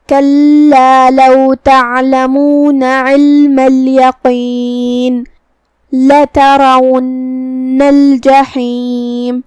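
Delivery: slow, drawn-out melodic tilawat; Quran recitation. Shown as a demonstration. Teacher recites the verse for students, slow melodic chant. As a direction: chant each word long and measured like a qari.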